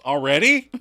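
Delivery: loud, sharp already; surprised interruption. Retold or imitated. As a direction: cut in surprised. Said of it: "Phoebe interrupts".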